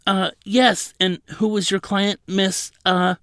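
high, loud, flustered stammer; startled attendant. A narrator's reading. Jumpy attendant answering a forceful counsel, startled and flustered. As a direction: caught off guard, fumbling for the name. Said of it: "startling the attendant so much that he jumped".

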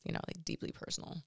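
hushed, breathy ask; whisper. Retold or imitated. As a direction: drop to a secret whisper. Asking to share something private.

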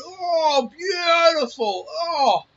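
high, loud cry of delight; ecstatic praise. Retold or imitated. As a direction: gush over the beer like it's heaven. Grandpa praising his homebrew.

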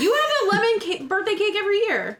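high, loud surprise; shocked it's every year; surprised. Real, not acted. Surprised at yearly lemon cake.

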